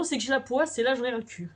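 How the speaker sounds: ordinary talk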